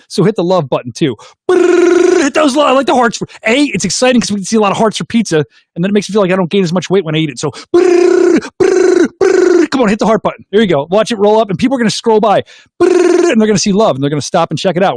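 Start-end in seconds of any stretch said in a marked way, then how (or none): 1.5-2.2 high, loud rolled brrr; long high-pitched brrr; excited trill. Real, not acted. Trilling to cheer on the hearts. 7.7-9.7 repeated high rolled brrrs; excited trill. Real, not acted. Trilling to cheer on the hearts. 12.8-13.3 quick high rolled brrr; short high-pitched brrr; excited trill. Real, not acted. Trilling for the scrolling hearts.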